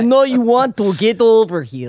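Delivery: loud, hammed-up Russian come-on; mock-Russian seductress on the edge of laughing; Russian accent. Co-host coaxing him over as the wife, mock Russian accent. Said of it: "in a Russian accent".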